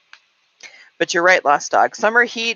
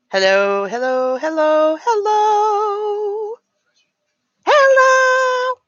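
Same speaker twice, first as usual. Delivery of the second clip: each hello climbs higher, up to a loud squeak; rising hellos; 4 dB louder, much slower than usual. Real, not acted. Testing if the speakers carry her voice, climbing hellos. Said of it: "Are you hearing me out of these speakers".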